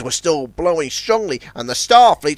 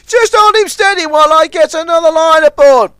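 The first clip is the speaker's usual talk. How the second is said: high urgent plea; pleading; 13 semitones higher, 9 dB louder than usual. A narrator's reading. Ten Cents pleading with Zebedee, high. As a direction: beg him to help. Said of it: "pleaded Ten Cents".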